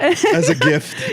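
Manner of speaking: very high, slow words through laughter; laughing disbelief. Real, not acted. Comic echoing the gift detail, high laughing disbelief.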